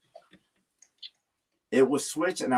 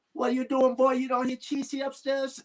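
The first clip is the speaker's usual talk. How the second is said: high-pitched scolding mom impression, voice pushed well up; imitating his mom; 9 semitones higher than usual. Son mimics his mom scolding him over the crying baby, high-pitched mock scold. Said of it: "She says".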